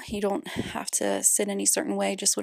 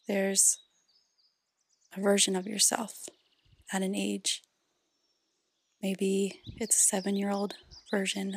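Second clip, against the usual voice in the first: guided meditation; 6 dB quieter than usual. Acted. Host leads parents through inner child healing, guided meditation. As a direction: guide them gently inward, softly, leaving space between phrases.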